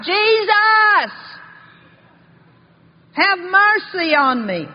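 loud, high shout for help; crying out. Retold or imitated. Blind beggar calls to passing Jesus, crying out loudly. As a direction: belt out the name, then a drawn-out plea. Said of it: "He began to cry out".

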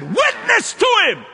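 high shouted command; the Lord commanding. Retold or imitated. The Lord orders the angry man, a high loud command. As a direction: proclaim it loud from above. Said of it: "And the Lord said".

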